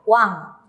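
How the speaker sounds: slow "wan" with a ringing nasal end; Korean syllable wan. Shown as a demonstration. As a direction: model "wan" for the class. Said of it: "se pronuncia".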